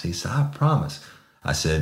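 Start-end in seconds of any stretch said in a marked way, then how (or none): 0.3-1.0 high, eager pleading promises; pleading suspect. Retold or imitated. Suspect promising the sergeant respect, high and pleading. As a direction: grovel and promise, voice way up.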